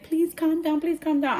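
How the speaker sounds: high, hurried imitation of begging; scared pleading. Scared hairdresser follows client to bathroom, pleading. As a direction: mimic a nervous hairdresser. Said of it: "I know that lady was scared".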